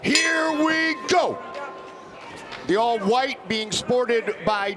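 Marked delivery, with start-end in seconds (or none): 0.0-1.4 high, drawn-out fight call; excited. Real, not acted.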